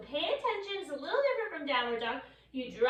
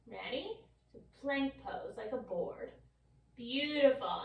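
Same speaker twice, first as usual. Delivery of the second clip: ordinary delivery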